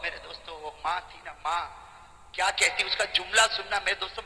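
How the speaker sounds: ordinary talk, nothing marked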